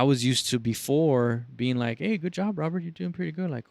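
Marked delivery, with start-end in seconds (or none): 2.0-3.5 high, friendly boss praise; cheerfully pat him on the back; praising boss. Retold or imitated. Boss praising the new worker, upbeat.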